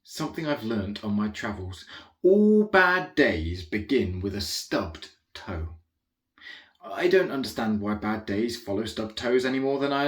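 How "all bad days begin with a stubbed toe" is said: slow pronouncement. Acted. Dramatic pronouncement of a rule.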